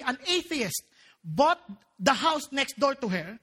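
ordinary delivery

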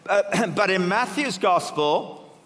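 normal talking voice

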